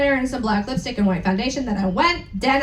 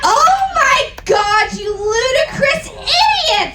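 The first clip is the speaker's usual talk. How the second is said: angry shout; 10 semitones higher, 3 dB louder than usual. Acted. Reading the fanfic aloud, a high, loud mock angry shout. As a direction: bellow the insult in hammed-up fury. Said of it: "they both shooted angrily".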